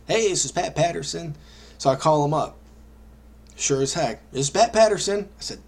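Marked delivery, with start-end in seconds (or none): 0.1-1.4 mock Southern accent, a bit higher; Pat's Southern voice. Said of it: "Pat's got this distinct Southern voice". 4.3-5.3 put-on Southern accent, louder and higher; Pat's Southern voice. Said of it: "Pat's got this distinct Southern voice".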